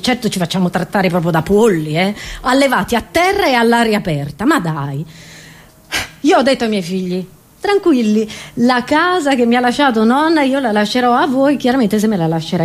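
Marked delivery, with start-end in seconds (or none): none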